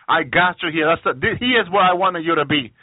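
ordinary talk

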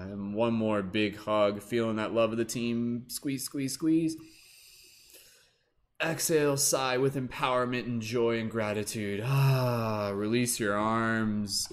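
rally the group through the breaths; guided breathing cues. Acted. Leads a group breathing hug.

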